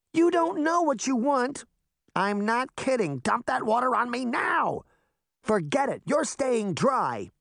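refusing to give up the bucket; stubborn little girl. Acted. Daphne fights the witch.

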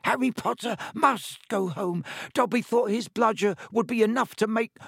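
high, squeaky elf voice, anxious and pleading; a fretful house elf begging Harry. Acted. Dobby urges Harry.

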